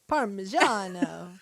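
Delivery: slow, hammed-up Italian Parmigiano; mock Italian flourish; Italian accent. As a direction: imitate her Italian accent, drawn out. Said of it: "that's when she'll be like".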